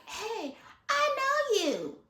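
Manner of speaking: high, slow knowing drawl; lightly voiced nosy classmate; slow sly classmate. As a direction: tease like you've caught him out.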